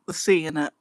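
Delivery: ordinary talk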